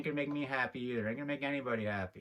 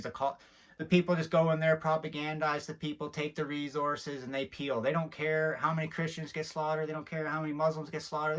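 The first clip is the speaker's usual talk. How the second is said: ordinary delivery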